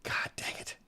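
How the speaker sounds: frustrated whisper under the breath; whispered curse. Real, not acted. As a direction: hiss it to yourself. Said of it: "whispered curse".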